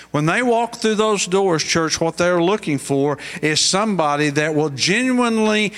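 high, fervent preaching voice; preaching at full force. Acted. Preacher urging the congregation toward caring, in fervent preaching. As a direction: lift the church with rising fervour.

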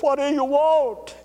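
high, slow, startled ask; startled question. Retold or imitated. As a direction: ask it as if woken by a voice. Chaplain answers God at night.

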